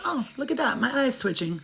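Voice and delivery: light, easygoing observation; casual noticing. Retold or imitated. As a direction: remark on it as no big deal. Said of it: "or if you just say".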